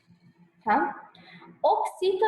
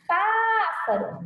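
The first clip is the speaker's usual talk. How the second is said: "Pássaro" called out, first syllable high and stretched; calling out the word; 6 semitones higher, much slower than usual. Shown as a demonstration. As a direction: draw out the PÁ of "Pássaro" as if calling someone. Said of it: "chama a palavra". (chamando "Pássaro", com o PÁ agudo e alongado)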